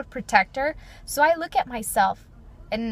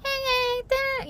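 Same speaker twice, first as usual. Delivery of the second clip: much higher, light chirpy hey hey; high girly voice; 14 semitones higher, slower than usual. Shown as a demonstration. Showing the high voice she does not have, much higher. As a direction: chirp it up high like a little girl. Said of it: "my voice is not like".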